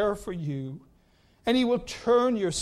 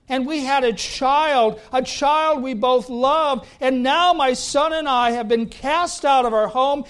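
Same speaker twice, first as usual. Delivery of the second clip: Hagar's lament, louder and higher; imagined Hagar; 4 semitones higher, 6 dB louder than usual. Retold or imitated. Preacher voicing Hagar cast out and about to die, raised and pleading. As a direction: pour out Hagar's despair in a raised voice. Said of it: "I'm sure she thought".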